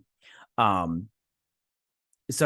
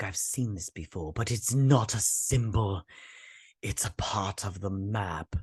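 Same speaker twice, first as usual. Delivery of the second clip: slow, hushed dramatic revelation; grave heroine imitation; 3 semitones lower, 4 dB quieter, slower than usual. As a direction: draw it out softly, ending near a whisper. Acting out the heroine's grave discovery.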